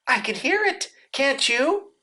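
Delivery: high little anxious voice; anxious Piglet. A narrator's reading. Piglet as they got near the trap, high and anxious. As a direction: ask it high and nervously. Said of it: "said Piglet anxiously".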